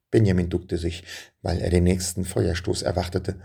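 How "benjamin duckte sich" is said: ordinary delivery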